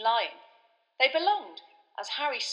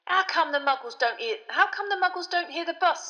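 put-on boy's voice, higher; 9 semitones higher, 5 dB louder than usual. A narrator's reading. Harry voiced in the story.

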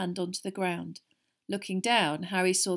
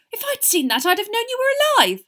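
upset mother; 10 dB louder than usual. A narrator's reading. Molly upset, choking on tea.

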